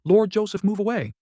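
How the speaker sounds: urgent loud yell; shout. A narrator's reading. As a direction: shout him clear of danger.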